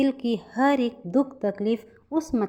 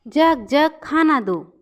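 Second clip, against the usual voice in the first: loud, high summoning call; calling out a command; 6 semitones higher, 7 dB louder than usual. A narrator's reading. Fisherman asks the magic jug for food, calling loudly. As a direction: order it up like a magic spell.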